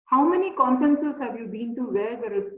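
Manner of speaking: ordinary talk, nothing marked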